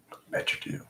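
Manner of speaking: playful hushed whisper; teasing whisper. Acted. Teasing viewers after the ASMR bit, whispered. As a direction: tease the listener under your breath.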